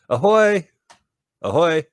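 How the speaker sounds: high, loud nautical hail; calling out. Acted. Visitor on the pier hails the yacht, calling out. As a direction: holler out to the boat. Hailing people aboard the yacht.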